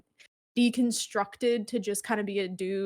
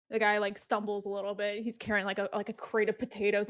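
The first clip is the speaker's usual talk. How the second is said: usual speaking voice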